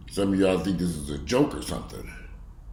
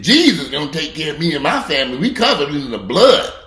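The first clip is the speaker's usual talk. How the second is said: mocking believers; 7 semitones higher, 8 dB louder than usual. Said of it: "ignorant motherfuckers who said".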